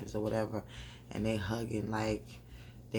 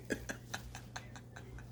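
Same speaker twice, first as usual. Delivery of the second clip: breathy laugh; 13 dB quieter than usual. Real, not acted. Laughing at own mouth.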